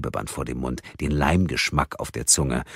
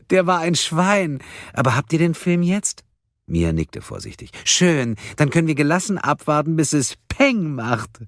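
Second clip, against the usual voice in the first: gloating madwoman; 12 semitones higher, 4 dB louder than usual. Acted. Karen gloating over the bomb.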